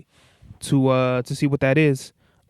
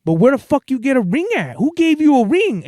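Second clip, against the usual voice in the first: insistent demand; 7 semitones higher than usual. Retold or imitated. Uncle insisting on the question.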